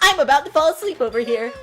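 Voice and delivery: bored whine. Acted. Bored mocking complaint.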